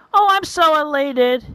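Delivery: high, gushing delight; elated. Acted. Man about to have a drink with a girl, elated. Acts out joy at the date.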